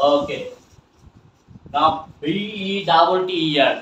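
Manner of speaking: normal talking voice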